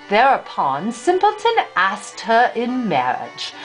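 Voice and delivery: ordinary delivery, nothing marked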